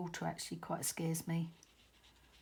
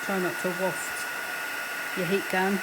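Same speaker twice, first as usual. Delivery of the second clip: raised voice over a loud heat gun; talking over noise; 9 dB louder than usual. Real, not acted. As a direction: project loudly over the machine noise. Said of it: "Sorry about that noise".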